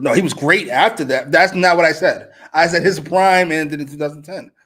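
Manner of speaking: exasperated correcting a straw man; heated rebuttal. Acted. Arguing with fans about Kobe's prime, loud and heated. Mock arguing with imagined critic.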